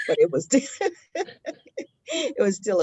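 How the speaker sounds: real giggles through the words; giggling. Real, not acted. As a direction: laugh while recalling it. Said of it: "the giggles and the smiles".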